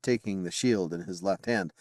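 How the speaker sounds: usual speaking voice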